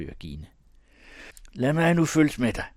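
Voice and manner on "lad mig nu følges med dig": high, loud, impulsive demand; losing self-control. Acted. Thomas pushing in beside Jørgine, uncontrolled outburst. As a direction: burst out, unable to hold it in. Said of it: "uden at kunne beherske sig".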